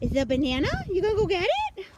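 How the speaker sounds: high excited pet talk; squeaky praising a dog. Real, not acted.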